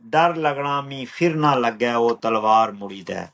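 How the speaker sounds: rhythmic, measured verse with a returning refrain; poetry recital. Acted. Poet reciting a poem on migration, measured rhythmic recital. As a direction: recite it unhurried, falling on each refrain.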